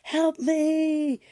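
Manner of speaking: high, slow pleading cry; frightened plea. Retold or imitated. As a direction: beg in fear of the coyote. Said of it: "he's frightened".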